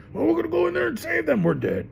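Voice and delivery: high, fast bravado then flat; mock reckless guy. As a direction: rush in like a daredevil. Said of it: "the guys who are crazier than her".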